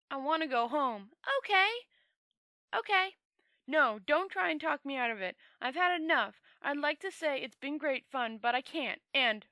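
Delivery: a weary wizard who has had enough, pushing the point loud; Rincewind insisting. Acted. Rincewind insists on going home.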